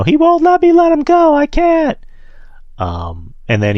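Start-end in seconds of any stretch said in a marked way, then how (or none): 0.0-2.0 loud, very high mock-desperate cry; high, strained, hammed-up yelling; Roman Reigns. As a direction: imitate a wrestler wailing in protest at the top of the range. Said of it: "Roman's saying, you know".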